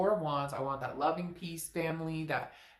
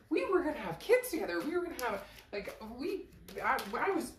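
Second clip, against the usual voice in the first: shocked disbelief; 8 semitones higher than usual. Retold or imitated. Querent shocked at ex's return.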